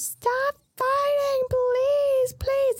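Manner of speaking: put-on high, slow, pleading girl's voice; begging Vivi. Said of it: "she's begging for".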